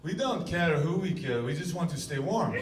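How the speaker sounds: put-on quiet deadpan Russian accent; calm Russian menace, soft. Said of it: "They're like".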